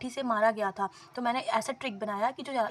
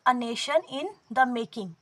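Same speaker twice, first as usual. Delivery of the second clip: each word of the title drawn out slowly; slow title reading; much slower than usual. Shown as a demonstration. Teacher reads a book title to aspirants, slowly and deliberately. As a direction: spell out the title slowly for students.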